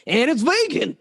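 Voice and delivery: Southern twang. Pretend. Host adding a sales point, high Southern twang. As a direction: pitch it up in a hammed-up Southern twang. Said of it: "We need that Southern twang".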